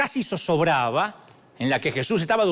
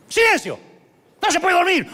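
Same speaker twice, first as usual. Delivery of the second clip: much higher, loud telling-off; scolding shout; 11 semitones higher, 4 dB louder than usual. Acted. Jesus scolds the storm like a child, loud.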